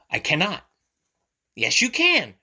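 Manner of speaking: lively picture-book read-aloud; reading to children. A narrator's reading. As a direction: read it brightly to little ones.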